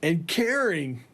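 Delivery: hammed-up outraged lecture; laughing mock rant. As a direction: scold the band while cracking up. Ranting at bandmates, laughing.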